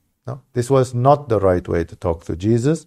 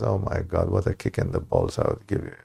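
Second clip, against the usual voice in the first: much lower and softer, a deep rumbling mock God; God's exasperated reply; 6 semitones lower, 9 dB quieter than usual. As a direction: drop way down for a fed-up God. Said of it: "and God says".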